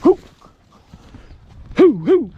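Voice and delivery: high loud hoots of excitement; excited whoop. Real, not acted. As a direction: whoop as the rabbit bolts. Excited hunter whooping at bolt.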